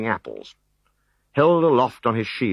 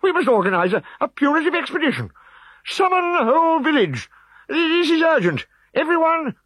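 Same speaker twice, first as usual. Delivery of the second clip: high, loud urgent orders; rally the village at once; high loud bossy command; commanding chief; 4 dB louder than usual. A narrator's reading.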